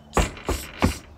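sharp voiceless "tss" repeated; monkey chatter "tss". Acted. Monkeys stamp back at the peddler, tss noises. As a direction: spit out three short tss sounds. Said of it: "monkeys only stamped both their feet back".

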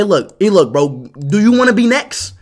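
usual speaking voice

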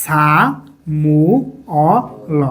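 "সা মু অ ল" slowly, each sound apart; long pauses between "সা", "মু", "অ", "ল"; syllable by syllable. Shown as a demonstration. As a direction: model "সা মু অ ল" in separate syllables. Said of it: "এখন উচ্চারণটা কি হবে?".

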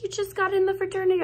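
high, fast, disbelieving voice; incredulous boyfriend. Retold or imitated. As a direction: fire it back in quick disbelief. Quoting Jason's doubting reply.

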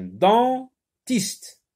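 "dentiste" drawn out as den... tiste; very slow "dentiste" with a gap after den; syllable by syllable. Shown as a demonstration. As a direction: sound out "dentiste" in two parts. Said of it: "on entend le mot dent".

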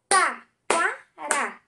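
"Taquara" in three loud beats, Ta - qua - ra; syllable by syllable. Shown as a demonstration. Teacher leads pupils clapping, "Taquara" split into Ta, qua, ra. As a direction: punch and separate each syllable of "Taquara". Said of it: "Façam junto comigo".

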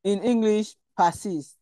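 usual speaking voice

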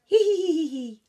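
high, loud tickled giggling; snake laughing. A narrator's reading. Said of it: "laughed Snake".

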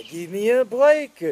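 put-on candy jingle in a French accent; mock French accent. Repeated jingle.

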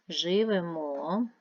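slow Живемо, held on final -мо; stress on third syllable. Shown as a demonstration. Teacher sorting words by stress, third-syllable stress on Живемо. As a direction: stretch the last syllable of Живемо. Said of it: "Третій".

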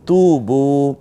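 তু বু with তু stretched and stressed; lengthened tu. Shown as a demonstration. Teacher modelling the madd in তু বু. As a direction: hold the u of তু long. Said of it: "একটু টেনে বলবো".

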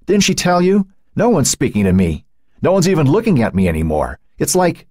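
high, hurt outburst; distraught teenager losing control; emotions breaking. A narrator's reading. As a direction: pour it out, close to breaking. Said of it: "Matt's control over his emotions broke".

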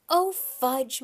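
dismayed mother. A narrator's reading. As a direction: exclaim it as a scolding mum. Mother scolding birthday boy over cake.

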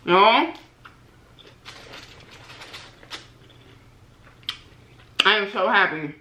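chewing while speaking; talking between bites; mouth full. Real, not acted. Seen 1: Ate egg roll just before; cheek bulges, chewing motions to 4.0s.

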